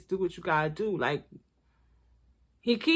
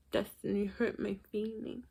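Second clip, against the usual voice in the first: mock sad; 11 dB quieter than usual. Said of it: "mock Sean".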